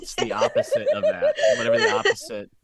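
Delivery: very high, loud, laughing through the words. Real, not acted. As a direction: break up laughing. Laughing, high, the opposite of what was described.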